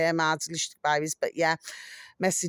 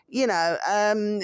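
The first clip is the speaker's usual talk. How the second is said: usual speaking voice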